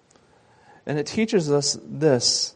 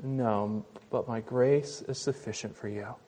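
gentle voice of Jesus; 4 semitones lower, 11 dB quieter than usual. Acted. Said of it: "And Jesus responded".

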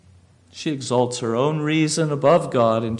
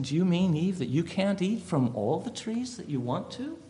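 voicing the serpent; 4 semitones higher, 7 dB quieter than usual. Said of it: "he's saying".

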